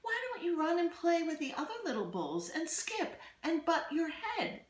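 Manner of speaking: a mother urging her calf to go play; mother cow's voice. Acted. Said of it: "she would say".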